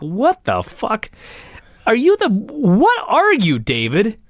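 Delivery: slow, incredulous mock outburst; stunned disbelief. Host mocking a biased announcer, incredulous and slow. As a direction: drag it out in disbelief. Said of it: "I was like".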